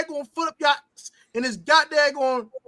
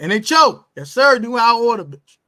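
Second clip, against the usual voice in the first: hype catchphrase; 3 semitones lower, 6 dB louder than usual. Real, not acted.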